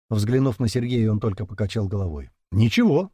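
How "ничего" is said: bright, upbeat high voice; cheerful. A narrator's reading. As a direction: say it briskly and cheerfully. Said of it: "бодро сказал мужчина".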